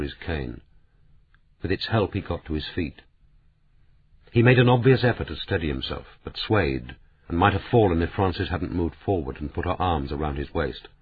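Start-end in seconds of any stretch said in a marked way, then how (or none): none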